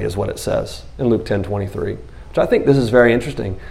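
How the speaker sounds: ordinary delivery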